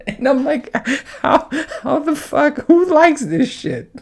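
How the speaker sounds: high, slow words through real laughter; can barely get it out for laughing; cracking up, voice up high. Real, not acted. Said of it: "I can't stop laughing".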